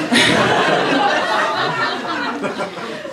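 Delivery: falling laugh under the breath; breathy laugh. Real, not acted. Laughing at own story.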